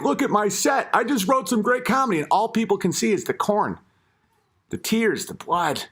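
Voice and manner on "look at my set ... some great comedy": a hint of a proud comedian, lightly higher; mock proud comic. Mocking the bear showing off his comedy set, light mock pride. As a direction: show off the new jokes, mildly mocking. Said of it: "he's like".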